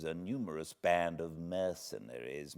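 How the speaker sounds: usual speaking voice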